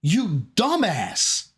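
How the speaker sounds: slow, emphatic put-down; exasperated scolding. Real, not acted. Host berates Phil over the email complaint, exasperated. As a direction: spell it out slowly, fed up.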